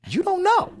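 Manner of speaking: squeaked very high; squeaky mock quote; mock surprise. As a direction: mimic her squeaky reply. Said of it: "you then told me".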